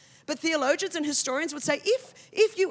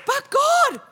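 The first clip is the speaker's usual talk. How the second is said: pleading with God; 6 semitones higher, 5 dB louder, slower than usual. Retold or imitated. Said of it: "So I said".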